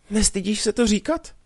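wail. Acted. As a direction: cry it out, hurt and ashamed for him. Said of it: "zaupěla Mařenka".

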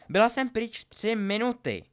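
high, loud scolding shout; furious yell. A narrator's reading. Ginny finds Harry and Draco wrecking the kitchen, yelling. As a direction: yell it in exasperation at the culprits. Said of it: "zařvala Ginny".

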